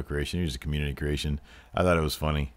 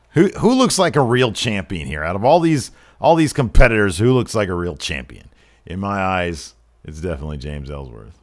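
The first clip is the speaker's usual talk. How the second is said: loud, raised hype to the crowd; showboating announcer; 7 dB louder than usual. Acted. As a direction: proclaim it like a wrestling announcer.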